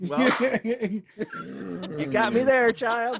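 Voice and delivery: grudging amused defeat; conceding. Retold or imitated. Mom conceding to her sassy child, amused. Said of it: "I'm like".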